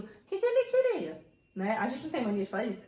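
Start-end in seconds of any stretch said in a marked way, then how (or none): 0.0-1.2 "O que que ele queria?" pitched high and loud, leaning on the doubled "que"; emphatic question. Shown as a demonstration. Teacher demonstrating an emphatic question to students. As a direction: push the doubled "que que" hard, really wanting to know. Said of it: "Eu quero enfatizar aquilo".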